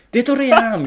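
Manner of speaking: surprised shout. Retold or imitated. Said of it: "みたいな".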